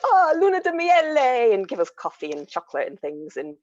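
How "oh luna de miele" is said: high, loud, slow Italian grandmother voice. Retold or imitated. Italian grandmother greeting the honeymooners, high and delighted. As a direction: gush it like a delighted Italian nonna. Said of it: "they'd be like".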